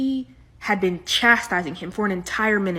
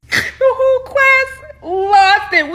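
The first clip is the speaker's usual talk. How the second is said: high laughing through words; 12 semitones higher, 5 dB louder, much slower than usual. Real, not acted. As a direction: laugh while telling it.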